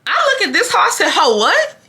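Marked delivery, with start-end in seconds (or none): 1.1-1.8 raised, indignant challenge; sassy, disbelieving retort; incredulous comeback. Retold or imitated. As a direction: toss it back as an affronted question. Acted comeback to neighbor.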